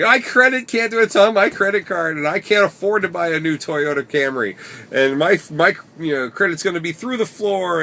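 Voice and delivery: put-on loud, high, frantic drunk whining; drunk panicked rant. Drunk Marcus wailing at friends about the lost rental keys, loud and high. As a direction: rant like a drunk man losing his credit.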